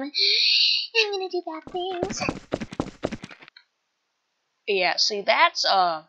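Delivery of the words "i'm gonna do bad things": very high, slow singsong menace; gleefully stretched high-pitched threat; unhinged threat. Acted. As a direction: draw it out in a high squeal. Plotting revenge, escalated take.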